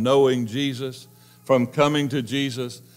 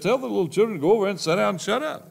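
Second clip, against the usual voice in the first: high, fast, brushing-off voice; mock-bossy disciple, high and fast; dismissive disciples; 8 semitones higher, much faster than usual. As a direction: shoo the kids away impatiently.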